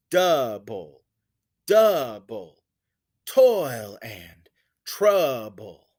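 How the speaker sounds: slow, loud DOU in "Double", falling away after; first syllables stressed. Shown as a demonstration. Teacher showing where the stress syllable falls, first syllables stressed. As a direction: pound the start of "Double", "toil", "trouble", word by word. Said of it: "the stress syllable is first here".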